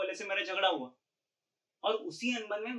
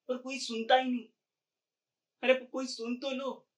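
higher-pitched, frustrated plea to be heard; pleading; 5 semitones higher than usual. Acted. Lonely Sameer begs anyone to listen to him, pleading.

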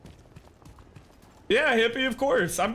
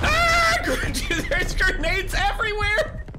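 much higher, louder, laughing; excited laughing yell; 4 dB louder than usual. Real, not acted. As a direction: shriek and laugh at the chaos. Grenades everywhere, laughing panic.

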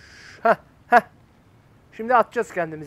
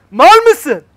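high, very loud yell at a partner; angry shout; 10 semitones higher, 16 dB louder than usual. Real, not acted. Player losing patience with his co-op partner, angry shouting. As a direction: lose your temper and yell. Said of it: "Bak, bağırttırma beni".

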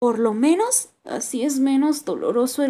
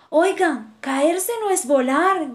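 higher, louder indignant call; angry crow shout; 5 semitones higher, 4 dB louder, slower than usual. A narrator's reading. Crow shouts at the leaving friends, angrily. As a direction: call after them, offended. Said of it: "grita el cuervo enfadado".

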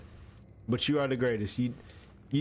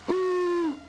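sharp startled gasp. Real, not acted. Startled by a creepy figure.

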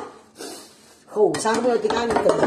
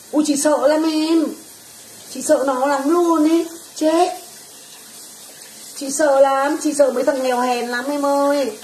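mock fright; 4 semitones higher than usual. Mock fear of poor people.

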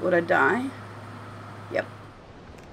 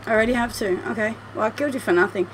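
normal talking voice

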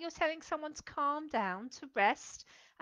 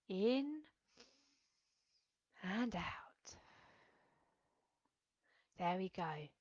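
much softer, breathing out to a whisper; calm breathing guide; 5 semitones lower, 9 dB quieter, slower than usual. Shown as a demonstration. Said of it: "I'd like you to be calm".

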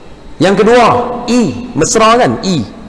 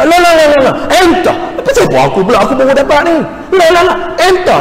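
heated denial; 11 semitones higher, faster than usual. Retold or imitated. Retold quarrel, heated exchange.

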